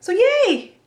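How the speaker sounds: high, cheery little cheer; real glee; yay. Real, not acted. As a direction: toss off a happy yay. Celebrating the band news.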